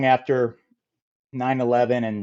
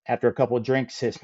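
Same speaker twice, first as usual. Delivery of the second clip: normal talking voice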